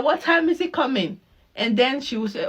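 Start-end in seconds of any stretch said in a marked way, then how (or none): 0.0-1.2 sweetly eager. Retold or imitated. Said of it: "I'll be like".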